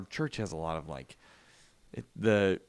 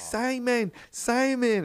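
put-on high toddler voice calling Simon; toddler calling dog; much slower than usual. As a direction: sing out the dog's name like a two-year-old. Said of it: "She was like".

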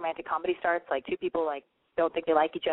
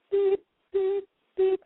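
mock beep; 11 semitones higher, 3 dB louder, much slower than usual. Mock phone cutoff noise.

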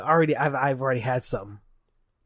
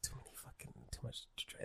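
muttered aside; 3 semitones lower, 26 dB quieter, faster than usual. Real, not acted.

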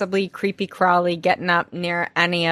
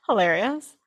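ordinary talk

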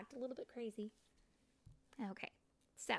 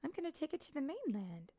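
lively fairy voice; 9 semitones higher, faster than usual. Acted. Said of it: "Iridessa said".

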